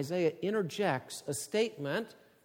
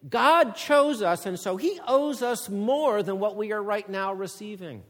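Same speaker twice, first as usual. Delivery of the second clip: loud, high, urgent pulpit voice; forceful preaching; 6 semitones higher, 9 dB louder than usual. Acted. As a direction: drive each phrase home to the congregation. Preacher contrasts two nations.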